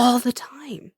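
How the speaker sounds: whispered under the breath; whispered aside. Real, not acted. Whispered aside about the same pairings all the time. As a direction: drop to a whisper.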